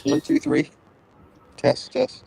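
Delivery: high, warped mic test; distorted alien-like mic check. Real, not acted. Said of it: "Still warping".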